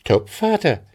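high, eager agreement; lively young woman's exclamation; girl calling out. A narrator's reading. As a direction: seize the bargain brightly. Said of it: "rief sie".

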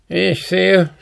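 high-pitched dwarf voice; Zwerg. A narrator's reading. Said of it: "sprach der Zwerg". (hohe Zwergenstimme)

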